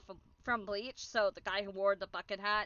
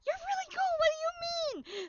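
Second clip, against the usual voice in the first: much higher, starstruck reply; gushing fan. Retold or imitated. Fan telling Gunrun he is cool, high and starstruck.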